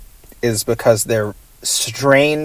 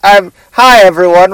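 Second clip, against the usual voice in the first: raised, louder goofy host voice; Professor Bug voice; 4 semitones higher, 5 dB louder than usual. Pretend. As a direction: play a silly bug scientist greeting the crowd. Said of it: "I'm just doing Professor Bug".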